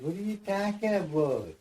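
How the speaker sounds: mock baffled challenge; bewildered question. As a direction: ask in disbelief. Confused worker questions another.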